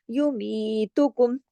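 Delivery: युमीतुकुम with the mi drawn out two counts; stretched mi. Shown as a demonstration. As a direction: lengthen मी in युमीतुकुम. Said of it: "यू मी को थोड़ा खींचा दो हरकत".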